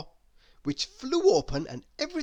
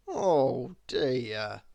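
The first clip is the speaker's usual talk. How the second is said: groaned; 4 semitones lower than usual. Acted. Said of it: "groaned Mister Happy".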